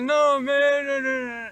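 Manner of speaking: high, loud, stubborn no; defiant whiny refusal. Retold or imitated. Bobby refusing.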